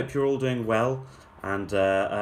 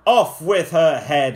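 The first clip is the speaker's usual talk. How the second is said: loud, higher royal roar; loud furious command; 6 semitones higher, 10 dB louder than usual. Acted. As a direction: bellow the order to execute. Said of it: "the prince roared back".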